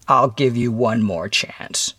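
slow, measured ultimatum; a touch of the stern interrogator; lightly voiced stern visitor. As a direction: offer it slowly and gravely.

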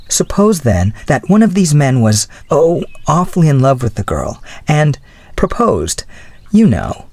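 lightly voiced young woman making excuses; flustered young woman. A narrator's reading. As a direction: lift the voice for a flustered girl.

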